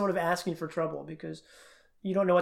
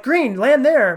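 louder, higher urgent command to self; urgent shout; 7 semitones higher, 9 dB louder, slower than usual. Retold or imitated. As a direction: call it out while falling. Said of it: "I'm like".

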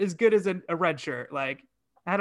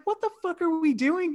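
much higher, exasperated disbelief; incredulous outburst; 11 semitones higher than usual. Retold or imitated. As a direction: throw up your hands in disbelief. Checking out of the movie.

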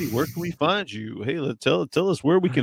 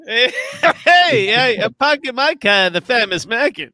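put-on Boston accent; 6 semitones higher than usual. Friend mimics Boston parking guy.

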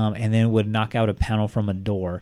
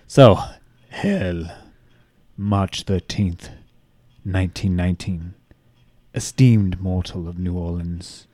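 dramatic letter reading; 4 dB louder than usual. Acted. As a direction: read it like a menacing old letter. S0 reads the Axeman letter.

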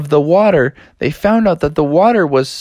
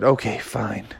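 soft, deflated reply; subdued giving in; 3 semitones lower, 8 dB quieter than usual. Acted. Froggy gives in to his mad dad, soft and subdued. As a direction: back down quietly.

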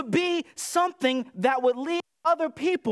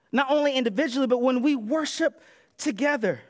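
ordinary delivery

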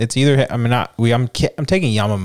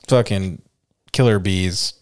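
ordinary talk, nothing marked

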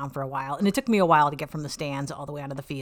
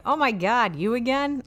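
imitating firemen; 6 semitones higher, slower than usual. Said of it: "And they're like".

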